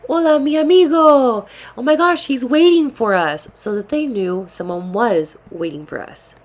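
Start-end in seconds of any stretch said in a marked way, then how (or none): 0.1-3.5 loud, high-pitched hail to a friend; re-enacted yell. Woman hailing a security guard to deter followers, yelling. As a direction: call out high and loud so others overhear. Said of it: "and I yelled".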